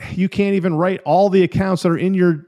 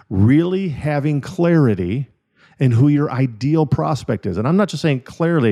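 usual speaking voice

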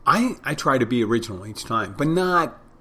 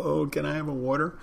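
higher, softly apologetic request; apologetic customer; 6 semitones higher, 3 dB quieter than usual. Retold or imitated. Customer asking bartender for free water, mock apologetic. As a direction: ask as if sorry to bother. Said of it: "they feel apologetic".

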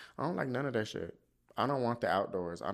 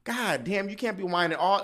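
loud, higher, slow exasperated yell at a whining dog; 8 semitones higher, 6 dB louder, slower than usual. Retold or imitated. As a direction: snap at the dog to quit it, drawn out and loud.

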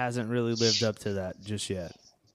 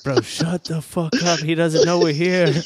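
mock panicked shout to hush friends; urgent loud hiss-shout; 6 semitones higher, 9 dB louder than usual. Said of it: "we're like".

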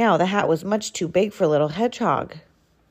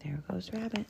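very soft, near whisper; barely voiced remark under the breath; hushed aside; 15 dB quieter than usual. Hushed aside about Rabbit passing.

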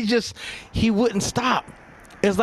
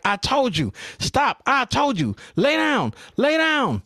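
a hint of a stern officer, higher on lay down; mock stern order; 7 semitones higher, slower than usual. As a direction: bark the orders like a fed-up cop, lightly. Imitating officer ordering him.

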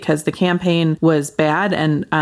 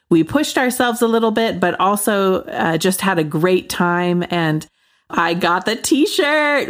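ordinary delivery

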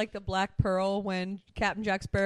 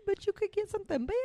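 mock nagging voice; 12 semitones higher, 3 dB quieter, much faster than usual. Voices someone arguing about the ship.

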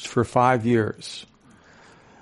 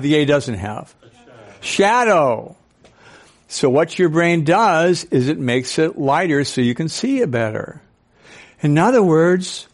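ordinary delivery

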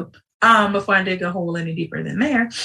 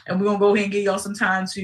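normal talking voice

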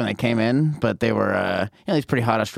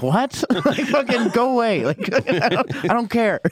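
laughing; 9 semitones higher than usual. Retold or imitated. Reacting to a drunk woman.

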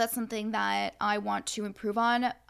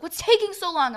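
high, loud mock whine of impatience; impatient complaint; 9 semitones higher, 8 dB louder than usual. Friend griping while waiting in a line, impatient mimicry.

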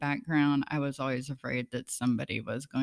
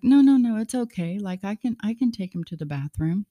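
quick, eager no no no, louder and higher; eager insisting; 5 semitones higher, 5 dB louder than usual. Retold or imitated. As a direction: jump in eagerly to volunteer. Husband eagerly insisting, retold.

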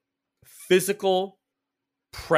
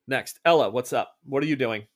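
ordinary talk

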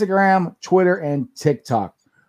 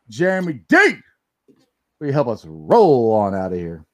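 ordinary talk, nothing marked

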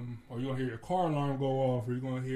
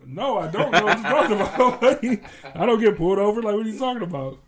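laughing mock denial; 7 semitones higher, 12 dB louder than usual. Teasing the friend at work, laughing loudly. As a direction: brush him off while cracking up. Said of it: "I'm like".

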